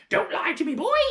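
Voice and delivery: high, loud hag bark; shouting hag. Acted. Grandma shouting at George, loud hag. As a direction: bark it down at him. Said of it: "the enormous old hag shouted".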